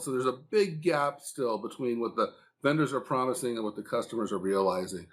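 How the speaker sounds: normal talking voice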